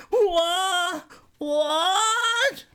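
put-on high old lady voice; Grandma asking. A narrator's reading. Grandma not hearing, high puzzled old voice. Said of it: "Grandma Robinson asked".

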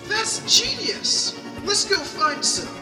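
high, loud thrilled outburst; excited. Acted. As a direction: cheer at the brilliant idea. Excited idea.

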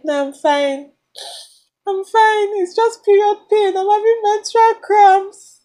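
fake-sob through the words; imitated crying. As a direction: daughter insisting to dad she's fine, mock crying. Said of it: "this is a fake imitation of how I cry".

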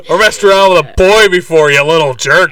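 mock scolding in a put-on uncle voice; jerk uncle. Parodying the uncle telling off Kevin, hammed-up jerk. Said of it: "I don't remember the uncle saying that".

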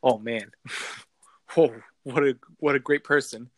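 ordinary talk

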